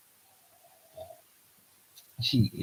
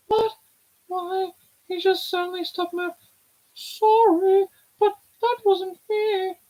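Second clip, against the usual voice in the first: put-on much higher, girlish and excited; high character voice; 6 dB louder than usual. Voicing a girl who can't move her legs, high and alarmed. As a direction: play the startled girl, bright and high.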